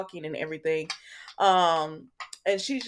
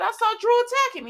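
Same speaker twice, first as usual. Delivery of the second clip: much higher, indignant mock insistence; insistent claim. [name] insisting to Chase, high indignant mock claim. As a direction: squeal the lie up high.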